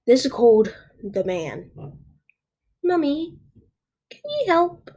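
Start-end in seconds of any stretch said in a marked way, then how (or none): 2.8-4.9 high small voice calling for mummy; pleading child. Acted. Child asking mummy for help, pleading.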